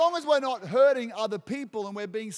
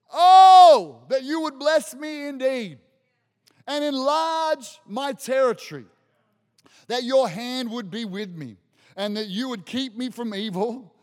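Jabez crying out; 3 semitones higher, 9 dB louder than usual. Acted. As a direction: cry of Oh, then lifted pleading prayer. Reading Jabez's cry to God.

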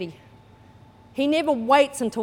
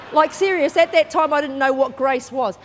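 ordinary talk

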